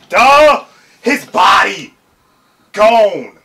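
loud, high, punched-out exclamations; shocked excited shouting. Real, not acted. Viewer reacting to a body destroyed, excited shouting. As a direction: shout each burst in disbelief.

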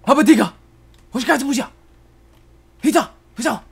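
high, loud gibberish yells; mock battle cries. Gamer attacking in the game, high shouted gibberish cries. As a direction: shout short bursts of nonsense war cries.

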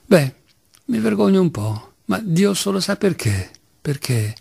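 usual speaking voice

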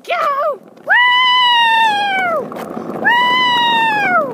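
very high, loud whooping cheers; excited whoop. Real, not acted. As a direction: whoop as the ride takes off. Cheering while riding down.

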